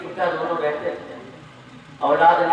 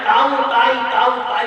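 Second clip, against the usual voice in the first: calling out; 6 semitones higher, 6 dB louder than usual. Acted. Neighbour calls through door.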